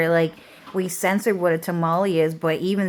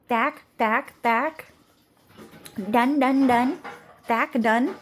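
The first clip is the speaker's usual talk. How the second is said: mock gibberish chatter; 6 semitones higher, slower than usual. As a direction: parrot their chatter as sing-song noise. Mocking the neighbors' talk.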